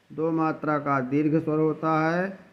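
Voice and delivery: ordinary talk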